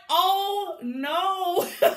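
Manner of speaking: high loud gasp into a laugh; shocked. Retold or imitated. Reacting to Jazz calling Hillary a runner, shocked laughing. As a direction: react like you can't believe it.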